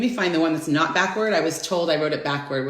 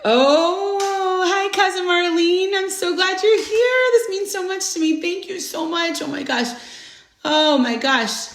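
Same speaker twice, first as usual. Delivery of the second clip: delighted greeting; 11 semitones higher than usual. Real, not acted. Greeting her cousin joining live.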